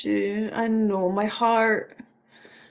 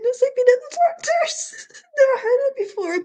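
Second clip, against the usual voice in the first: laughing again; 9 semitones higher, 3 dB louder than usual. Real, not acted. Still laughing at the scene.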